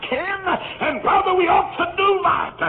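rally the congregation; preaching shout. Real, not acted. Preaching climax.

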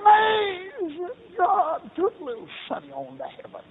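high, loud, breaking into a cry as the grieving father; wailing the terrible news through tears; grief-stricken father crying out the news; sobbing on the phone. Retold or imitated. Roy phones to report son's death.

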